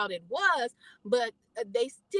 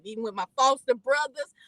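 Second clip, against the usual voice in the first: normal talking voice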